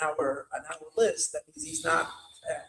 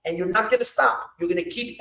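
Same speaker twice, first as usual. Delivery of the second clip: rising urgent; 6 semitones higher, 5 dB louder than usual. Retold or imitated. Rebbe urging him on.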